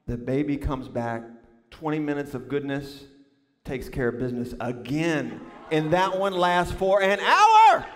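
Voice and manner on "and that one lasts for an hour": slow, loud build to a drawn-out last word; hammered out in comic outrage; exasperated climax. Real, not acted. Climax of baby ordeal story.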